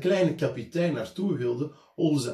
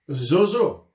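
loud, higher scolding; angry teacher; 7 semitones higher, 9 dB louder than usual. A narrator's reading. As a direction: call out in mounting anger. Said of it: "riep de meester". (de meester roept boos, luid en hoger)

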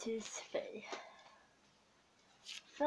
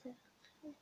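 usual speaking voice

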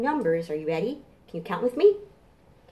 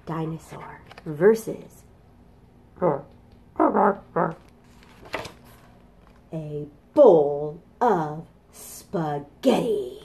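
slow, drawn-out storybook reading; reading to children; 6 dB louder, much slower than usual. Acted. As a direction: read it like a picture book.

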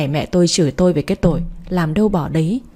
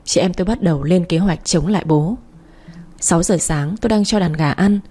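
ordinary delivery, nothing marked

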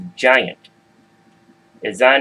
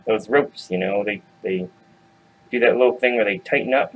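ordinary delivery, nothing marked

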